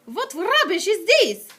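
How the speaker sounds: slow, loud, high scornful shout. Acted. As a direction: scoff it out loudly. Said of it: "shouted the Grand High Witch".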